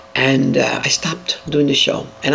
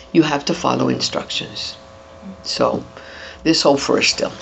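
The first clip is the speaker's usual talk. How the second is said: ordinary delivery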